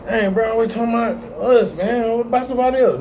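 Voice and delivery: mock the viewer who gripes about being picked on; hammed-up high griping; mock complaining viewer. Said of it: "Oh man".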